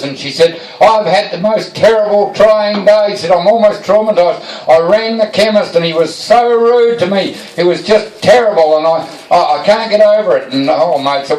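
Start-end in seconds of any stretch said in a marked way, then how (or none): 0.8-3.1 higher, teary wife voice, imitated by a man; upset wife. Wife in tears greeting husband at door, high and teary. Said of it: "she's in tears". 3.3-10.5 higher, teary wife voice, imitated by a man; upset wife. Wife in tears greeting husband at door, high and teary. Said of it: "she's in tears".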